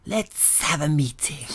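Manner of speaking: slow, high, hissing reptile. Pretend. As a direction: slither it out like a lizard. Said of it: "Trying to do a reptile voice there".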